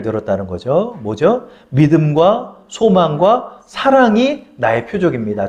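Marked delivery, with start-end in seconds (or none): 1.7-5.5 slow, evenly spaced phrases; measured declaration. Shown as a demonstration. Preacher names the three signs to congregation, slow declaration. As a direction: set out each item slowly.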